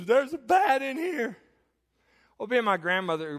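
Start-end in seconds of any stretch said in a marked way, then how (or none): 0.1-1.4 high, loud, slow terrified stammer; panicked child. Retold or imitated. Scared boy telling grandpa, acted high panicked cry. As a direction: wail it as a scared kid, hammed-up. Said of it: "I screamed for my granddaddy".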